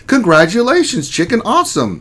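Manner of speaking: high-pitched cheer; playful congratulations. Pretend. Streamer cheering a viewer's new job, playful high voice. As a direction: lift the voice way up to congratulate.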